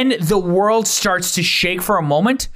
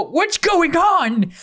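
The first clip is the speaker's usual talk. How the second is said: higher, slow alarmed question; old mayor startled by the shaking; startled duck mayor; 12 semitones higher, much slower than usual. Acted. De Niro startled as the world shakes, high and slow. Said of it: "you hear De Niro go".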